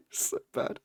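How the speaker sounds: high, squeaky words through laughter; laughing. Real, not acted. Streamer reacts to game character's greeting, laughing. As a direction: break up laughing while speaking.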